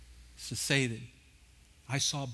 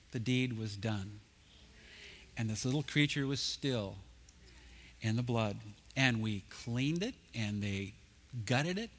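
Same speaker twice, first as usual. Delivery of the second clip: solemn hushed storytelling; 3 semitones lower, 3 dB quieter than usual. Acted. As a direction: let the moment settle, hushed and grave. Solemn retelling of the slaughter.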